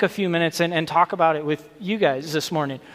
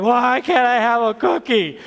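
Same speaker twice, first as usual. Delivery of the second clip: mock whine of a child denied a cookie, pitched up; whiny kid; 6 semitones higher, 3 dB louder than usual. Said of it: "They're gonna whine and cry and be like".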